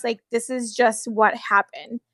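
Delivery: ordinary talk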